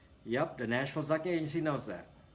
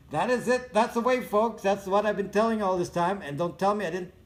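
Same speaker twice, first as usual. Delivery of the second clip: gloating; 6 semitones higher, 5 dB louder than usual. Real, not acted. Gloating to viewers.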